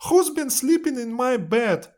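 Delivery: put-on very high, loud baby bear squeal; tiny bear's high-pitched complaint. A narrator's reading. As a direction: squeak it out like an upset little bear. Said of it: "Baby Bear looks at his bed".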